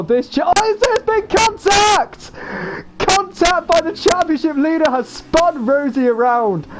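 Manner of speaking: excited shouting. Real, not acted. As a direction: scream the overtakes with excitement. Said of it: "I got so excited. I thought I was just shouting over you".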